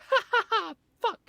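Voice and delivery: mock child cackling a curse word; imitating a toddler. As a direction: laugh and swear like a delighted toddler. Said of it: "all of a sudden he's like".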